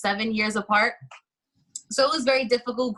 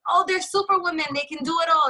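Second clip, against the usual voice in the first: mock gushing praise; 7 semitones higher, faster than usual. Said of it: "people glorify motherhood like it's".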